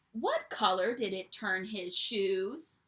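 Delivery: plain reading voice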